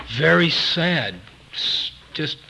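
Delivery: ordinary talk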